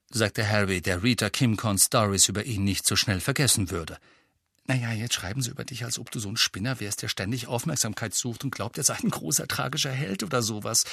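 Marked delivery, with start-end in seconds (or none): 4.7-10.8 rushed, fast delivery to get it over with; fast, hurried explanation to spare his feelings. Acted. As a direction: rattle it off quickly. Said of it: "sagte Hermine sehr schnell".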